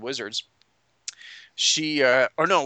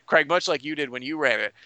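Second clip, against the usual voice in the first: usual speaking voice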